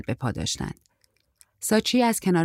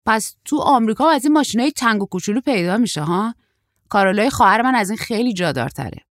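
a hint of a surprised young man, higher and louder; raised, lively character voice, lightly done; lightly voiced young surfer; 4 semitones higher, 6 dB louder than usual. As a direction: lightly play a chatty young traveller.

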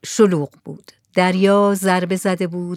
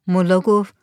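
plain reading voice

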